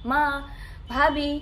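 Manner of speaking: ordinary talk, nothing marked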